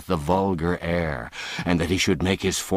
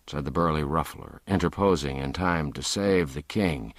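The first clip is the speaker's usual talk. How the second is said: plain reading voice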